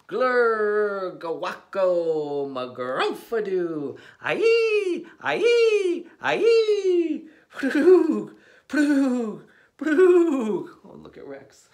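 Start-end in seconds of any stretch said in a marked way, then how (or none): 0.1-4.0 loud, goofy nonsense burbles; loud silly sounds. Acted. Reader forced into silly sounds, loud gibberish. 4.2-10.7 high, loud squealing aye and ploog; laughing nonsense cries. Acted. Reader making silly sounds for the kid, squealing laughter.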